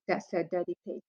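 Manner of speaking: usual speaking voice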